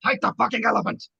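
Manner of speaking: furious hoarse yell; hoarse with rage. Acted. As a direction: scream it at her in rage. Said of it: "in a voice hoarse with rage".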